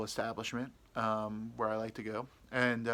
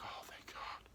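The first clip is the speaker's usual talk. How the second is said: whispered relief; 15 dB quieter, slower than usual. Retold or imitated. Relief, aside.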